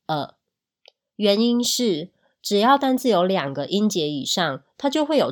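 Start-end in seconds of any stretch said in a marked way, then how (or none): 0.1-0.3 呃 as a short schwa; unstressed O sound. Shown as a demonstration. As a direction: say "呃" for the reduced O. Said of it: "这个O读的是". (把O读成弱化的“呃”)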